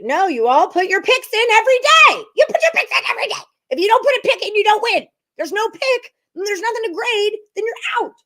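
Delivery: high, loud frustrated yelling; screaming. Real, not acted. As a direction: scream it out at a chat viewer. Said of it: "Sorry to scream at you".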